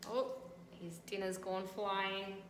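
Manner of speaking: ordinary delivery, nothing marked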